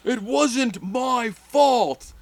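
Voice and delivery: high slow mock protest; mock Paige whine. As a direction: drag it out like a whining Paige.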